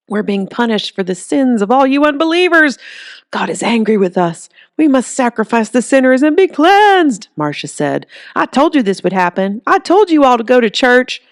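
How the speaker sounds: a Bible-thumper condemning sinners; fire-and-brimstone preacher. Acted.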